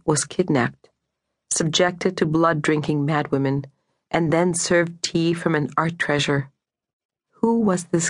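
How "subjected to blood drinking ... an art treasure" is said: usual speaking voice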